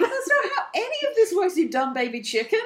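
very high, indignant protest; mock exasperated squawk. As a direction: squabble at a silly high pitch. Acted quarrel with the betrayer.

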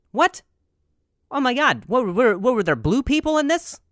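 high, loud, incredulous outburst; mock astonishment. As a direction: overplay being amazed by the news. Acts astonished at James Cameron producing.

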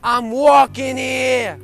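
mock New York shout. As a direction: bellow it like a cabbie in traffic.

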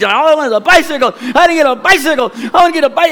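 put-on boy whining to his mom; whiny kid. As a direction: play the sulking boy. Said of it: "I can see Ethan and me".

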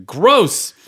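loud disgusted yelp; disgusted outburst. Real, not acted. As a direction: blurt out your disgust. Disgust at naked foot.

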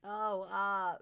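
ordinary talk, nothing marked

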